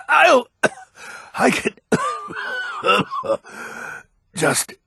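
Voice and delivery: words broken by hacking coughs; coughing fit. Acted. Sick old man struggling through a coughing fit. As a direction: choke out the words between coughs.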